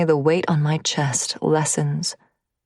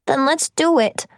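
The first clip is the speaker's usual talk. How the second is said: lightly voiced whimpering boy, higher; a tearful little plea; whimpering child; 9 semitones higher than usual. A lightly voiced boy begging mom, whimpering. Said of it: "he whimpers".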